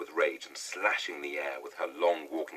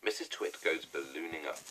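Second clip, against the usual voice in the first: ordinary delivery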